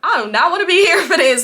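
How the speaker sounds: high, loud, laughing protest; mock distress. Storyteller wanting out of the brothers' quarrel, mock distress. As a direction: whine it loudly through a laugh. Acts her own alarm.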